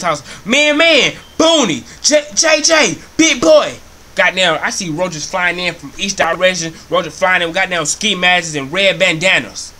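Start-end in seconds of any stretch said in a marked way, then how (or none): 0.4-3.7 loud, high roll-call hollers; calling out friends. Acted. Roach summoning all his roach friends, high shouted roll call. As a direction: holler each name sharply, as if across the block. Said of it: "Start calling all his motherfucking roach friends".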